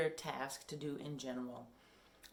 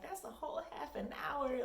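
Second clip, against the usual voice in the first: surprised; 11 semitones higher than usual. Retold or imitated. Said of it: "And I'm like".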